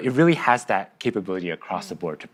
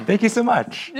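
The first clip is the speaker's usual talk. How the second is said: laughing; 12 semitones higher than usual. Real, not acted. Laughing gratitude.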